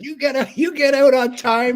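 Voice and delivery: high, slowed broadcaster impression; Bob Cole. Veteran broadcaster praises his color guy, high imitated voice.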